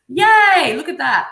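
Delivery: a drawn-out celebratory whoop; cheering. Real, not acted. Host cheers on Julia with the chat, cheering.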